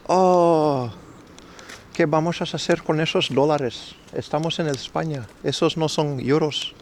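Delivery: playing a puzzled Spaniard in a mild Castilian lilt; mock Spaniard from Spain, mildly accented; Spanish accent. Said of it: "You think I can do a Spanish accent".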